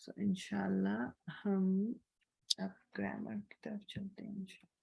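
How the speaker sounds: whisper. Real, not acted. Teacher whispering aside.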